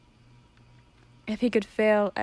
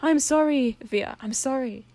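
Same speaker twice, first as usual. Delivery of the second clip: pleading apology; 5 semitones higher, slower than usual. Acted. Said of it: "Stolas said".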